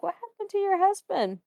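startled question. Retold or imitated. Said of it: "I was like".

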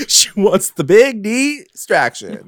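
"big distraction" stretched high and loud; playful innuendo. Pretend. Podcaster topping co-host's joke about the character, playful innuendo.

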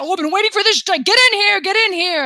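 high, excited, spluttering royal welcome; jovial, blustering king. Acted. King welcomes the long-awaited crew, excited and blustering.

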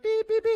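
high, loud electronic beeps; imitated detector beeps. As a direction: sound like a detector going off. Detector going off over perfect house.

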